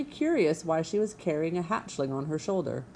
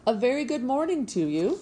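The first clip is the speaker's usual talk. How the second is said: cheery, higher-pitched hail; friendly booming; 7 semitones higher than usual. A narrator's reading. Robot hailing an old goose over the pond, friendly booming voice. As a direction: greet them brightly across the water. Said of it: "the robot's friendly voice boomed over the water".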